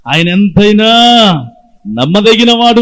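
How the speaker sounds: rally the congregation with it; preacher lifting the crowd to answer, loud; rousing call. Real, not acted. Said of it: "చెప్పండి".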